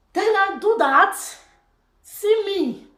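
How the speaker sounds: excited high exclaim. Real, not acted. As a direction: blurt it out thrilled.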